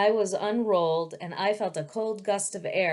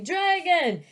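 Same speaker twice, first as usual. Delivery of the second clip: high, loud terrified yell; screaming servant; 10 semitones higher, 6 dB louder, slower than usual. A narrator's reading. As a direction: scream it out in panic. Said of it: "the servant screaming".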